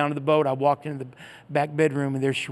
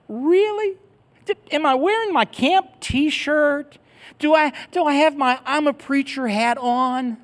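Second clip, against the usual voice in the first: much higher, slow mock disbelief; incredulous; 12 semitones higher, 3 dB louder, slower than usual. Asking the stranger if his shirt gave him away, incredulous. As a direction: ham up the exasperated disbelief.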